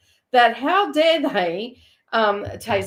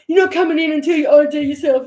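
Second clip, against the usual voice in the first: higher, louder, rushed retold demand; Ian's demand; 5 semitones higher, 7 dB louder, faster than usual. Ian in the paddy wagon to ambulance driver, mock indignant demand. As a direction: imitate a man shouting out from the back, fast. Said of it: "Ian in the paddy wagon turns around".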